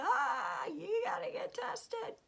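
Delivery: high, drawn-out hysterical wail; hammed-up alarm, stretched and high; mock panic. Mimicking school staff over a sneeze, mock panic.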